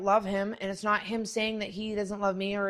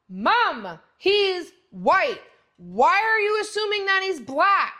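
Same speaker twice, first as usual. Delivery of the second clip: daughter voice indignant; 9 semitones higher, 7 dB louder, slower than usual. Retold or imitated. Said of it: "And I went".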